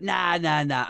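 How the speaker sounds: high, careless brush-off; dismissive. Retold or imitated. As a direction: wave it away lightly. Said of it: "Everyone was like".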